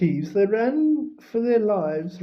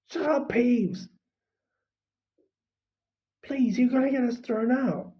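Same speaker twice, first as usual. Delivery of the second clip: higher, urgent, pleading hush; 6 semitones higher than usual. Acted. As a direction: beg him to stop, urgently. Harry pleading with Peeves.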